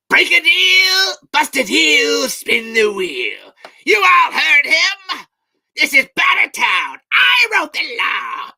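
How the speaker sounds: high, drawn-out theatrical shout; shouted movie quote. Acted. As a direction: bellow it like a film proclamation. Shouting Mad Max film lines.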